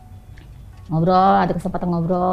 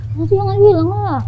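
pleading nephew; 9 semitones higher, 4 dB louder than usual. Retold or imitated.

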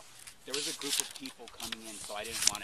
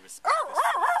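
loud; 14 dB louder, slower than usual. Real, not acted. Hiker retorts loudly to ranger.

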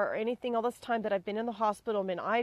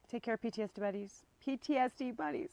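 teeth chattering; 7 dB quieter than usual. Real, not acted. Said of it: "I can't even talk".

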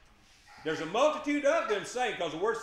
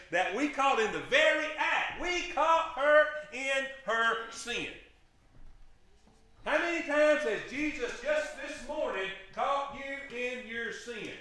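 emphatic preaching shout; 6 semitones higher, slower than usual. Real, not acted. Preacher rising to a slow, high shout at the congregation. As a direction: drive it home to the room.

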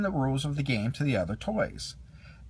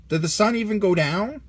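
Ham asking; 6 semitones higher, 4 dB louder than usual. A narrator's reading. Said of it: "Ham asked".

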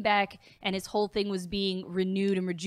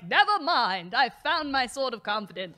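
mock hero; 2 semitones higher, 4 dB louder than usual. Fan parodies the hero's turnaround, mock-heroic. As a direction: proclaim it like a cartoon champion. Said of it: "the hero was like".

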